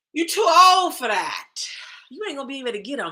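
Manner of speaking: high, loud mocking yell; mock shout. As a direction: shout it like a scornful critic. Mocking voice of society.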